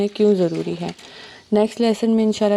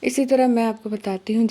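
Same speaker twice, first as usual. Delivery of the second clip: ordinary talk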